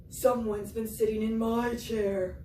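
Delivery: a hint of a gruff Papa Bear; Papa Bear voice, lightly done; lightly voiced Papa Bear. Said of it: "He said".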